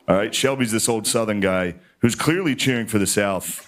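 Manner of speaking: usual speaking voice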